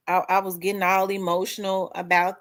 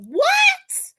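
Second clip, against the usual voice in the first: very high, loud disbelieving cry; shocked what; 5 dB louder than usual. Retold or imitated. Hearing she won the grant, a very high stunned squeal. Her shocked reaction retold.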